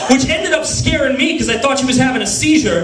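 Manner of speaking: normal talking voice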